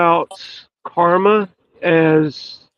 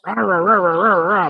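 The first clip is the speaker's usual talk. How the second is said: mock barking. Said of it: "barking at me".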